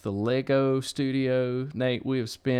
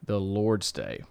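ordinary talk, nothing marked

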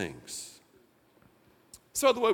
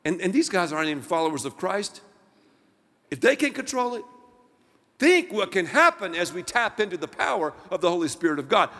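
ordinary delivery

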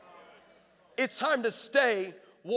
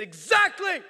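loud, higher shout of one word; God's shouted reply; 9 semitones higher, 7 dB louder, slower than usual. Retold or imitated. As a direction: boom it out like God answering. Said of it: "And he shouted".

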